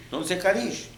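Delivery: ordinary talk, nothing marked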